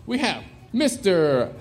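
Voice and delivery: loud, drawn-out game show intro; announcer voice. Real, not acted. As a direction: boom out each name like a host. Host announcing contestants loudly.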